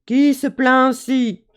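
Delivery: slow, loud fairy mother voice; a stern mother calling down the chimney; big voice. Acted. Fairy mother demanding who complains, slow and loud. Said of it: "une grosse voix".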